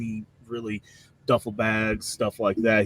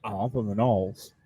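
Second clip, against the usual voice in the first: put-on Cajun accent. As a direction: answer easily in a Cajun accent. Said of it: "thick Cajun".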